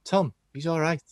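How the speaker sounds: badly faked Scouse footballer's call; bad Scouse accent. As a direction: attempt a Scouse accent, not convincingly. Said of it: "I can't do Scouse accents".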